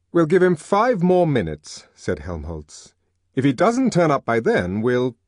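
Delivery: impatient. A narrator's reading. Helmholtz impatient.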